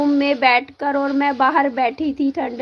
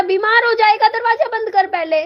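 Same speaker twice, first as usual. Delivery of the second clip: worried scolding; 7 semitones higher than usual. Real, not acted. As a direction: push him to shut the door before he falls ill. Mother scolds child in the cold. (बेटे को बहुत ऊँची, तेज़ आवाज़ में डाँटना)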